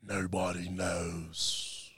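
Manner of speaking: very slow, hushed brooding Jon Snow; whispered Jon Snow impression. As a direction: breathe it out like a grim secret. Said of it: "That's strong".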